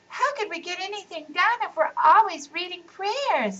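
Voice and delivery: put-on high, doubtful kid question; a child poking holes in it; skeptical child voice.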